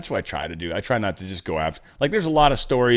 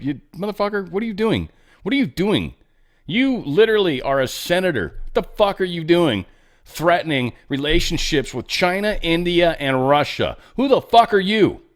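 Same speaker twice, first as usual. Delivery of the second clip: higher, indignant mock dressing-down; Trump telling someone off; 6 semitones higher, 3 dB louder than usual. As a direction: chew them out in disbelief, pitched up, building louder at the end. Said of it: "and Trump's like".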